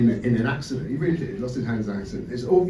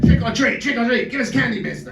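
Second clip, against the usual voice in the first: put-on higher, louder chanting kids at the door; kids trick-or-treating; 7 semitones higher, 4 dB louder than usual. Kids at the door demanding candy from the neighbour, chanting eagerly. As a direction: pipe it up like eager children demanding sweets. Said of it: "The kids come out like".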